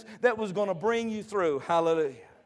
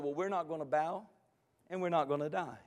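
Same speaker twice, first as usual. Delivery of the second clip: low, soft, calm refusal; understated, hushed defiance; quiet defiance; 3 semitones lower, 8 dB quieter, faster than usual. Acted. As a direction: answer a king quietly, without budging. Three men calmly defy the king.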